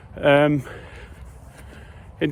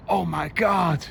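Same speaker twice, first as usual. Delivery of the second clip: a higher, stunned exclamation; amazed; 4 semitones higher than usual. Real, not acted. Vlogger sees a surprising poster, amazed exclamation. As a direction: let the shock burst out.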